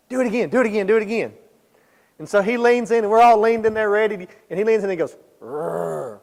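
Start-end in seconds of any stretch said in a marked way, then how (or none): 0.1-1.3 mildly excited, quick repeated urging; eager egging-on. Retold or imitated. Friends urging buddy to growl at the lion, eager mock chant. 5.4-6.2 short, soft imitated growl; little growl. Buddy leaning in to tease the lion, soft little growl. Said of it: "make his little growl".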